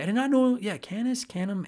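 ordinary delivery

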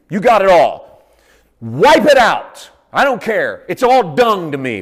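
loud, high, impassioned surrender; a preacher crying out his surrender to Jesus; fervent preaching. Acted. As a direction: pour it all out at full force. Preaching at full force.

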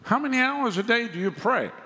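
high-pitched mimicry of a silly questioner; mocking questioner. Preacher mimics people asking about his praying, mocking.

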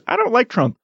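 squeaky high imitation of Romney; high mock Romney voice. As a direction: pitch it way up as a meek politician. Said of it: "Romney goes".

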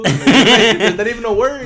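high, loud laughing line; laughing hard. Real, not acted. Co-host joking about Zelda text, laughing high. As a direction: lose it laughing. Cracking up at joke.

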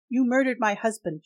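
furious accusation. Acted. Widow accusing the vampire of her husband's death, loud. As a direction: throw the blame at him.

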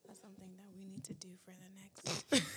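barely voiced, hushed whisper; very soft, secretive murmur; whispered aside. Real, not acted. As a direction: whisper it under your breath. Off-mic aside while recording starts.

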